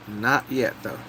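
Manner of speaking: usual speaking voice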